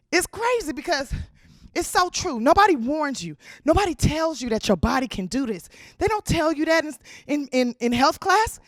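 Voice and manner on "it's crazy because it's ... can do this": ordinary talk, nothing marked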